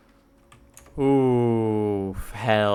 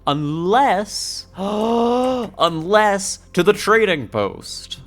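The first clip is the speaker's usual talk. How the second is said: high, loud sudden gasp of hope; slow, loud eureka moment; excited realization; 9 semitones higher, 8 dB louder, much slower than usual. Real, not acted.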